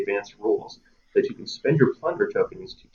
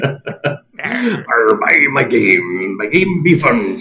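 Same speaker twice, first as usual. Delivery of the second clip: slow, louder pirate sales pitch; 3 semitones higher, 5 dB louder, much slower than usual. Acted. Pirate pitching his own game to backers, slow pirate voice. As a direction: hawk the game like an old sea dog. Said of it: "says I".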